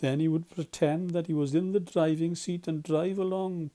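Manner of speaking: ordinary delivery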